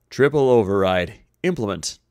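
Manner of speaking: loud, emphatic order; urgent command. A narrator's reading. Sulu orders the computer to override, loud and emphatic. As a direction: call out the command forcefully.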